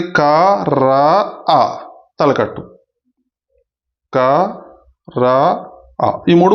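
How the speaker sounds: "క", "రా", "ఆ" said as separate slow syllables; teacher sounding out each part; sounds spelled out. Shown as a demonstration. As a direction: break క్రా into క, రా, ఆ one by one. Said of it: "క్రా పలికేటప్పుడు చూడండి".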